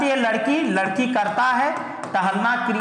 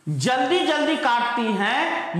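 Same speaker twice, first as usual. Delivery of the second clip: slow trial reading; 4 semitones higher, slower than usual. Shown as a demonstration. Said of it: "ये ज्यादा सही लग रहा है".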